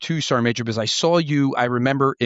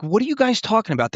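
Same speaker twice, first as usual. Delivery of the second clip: incredulous question; 10 semitones higher than usual. Retold or imitated. Lieutenant colonel to scout snipers, retold incredulous question. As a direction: pitch jumps well up in disbelief. Retelling his reply to young snipers.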